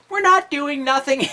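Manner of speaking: high, loud mock-innocent denial; imitated girl's indignant denial; protesting girl. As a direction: protest loudly in a put-on girl's voice.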